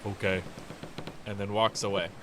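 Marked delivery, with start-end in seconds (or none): 0.1-0.4 understated, slightly lower Goliath voice; low-key Goliath, a brief acknowledgement; Goliath reply. Acted. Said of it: "Goliath looks at you and he goes".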